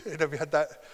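ordinary talk, nothing marked

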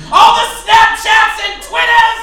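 high, loud sung Grinch rant. Retold or imitated. Bartender grumbling at teens about social media, loud sung Grinch voice. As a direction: belt it like the grumpy Grinch. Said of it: "I turn into the fucking Grinch".

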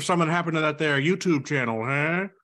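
fast, high, loud mobster threat; smiling menacing loan shark. Pretend. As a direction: lean in like a grinning mobster shaking someone down. Threatening gangster voice bit.

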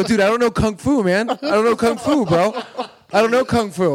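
high loud pleading; mock plea. Comic pleading with an angry man, loud. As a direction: beg him off, half laughing.

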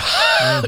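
sudden high-pitched gasping laugh; imitated laugh. Teller imitates Belinda laughing in the car, shrieky laugh. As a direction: mimic someone helpless with laughter. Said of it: "Belinda was in the floorboard laughing".

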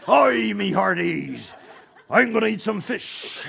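slow, loud hammed-up pirate shout. Preacher clowning as a pirate climbing the ladder, hammed-up. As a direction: ham it up like a stage pirate. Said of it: "Like a pirate".

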